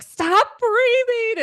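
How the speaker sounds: put-on high, loud, slow childish yell; kids yelling. Kids yelling at their breathing mom, a high loud slow yell. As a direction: yell it like an angry kid begging mom to stop. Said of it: "They're like".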